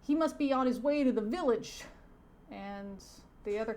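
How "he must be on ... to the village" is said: loud, high, hammed-up pleading. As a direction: urge the chief in cartoon alarm. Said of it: "stereotype cop says".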